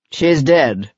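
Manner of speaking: falling, matter-of-fact; blunt, flat statement. Acted. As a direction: drop it coldly, no sorrow. Actor states death flatly.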